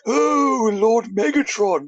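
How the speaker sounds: high, drawn-out fawning gush; simpering. Retold or imitated. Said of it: "saying simpish things like".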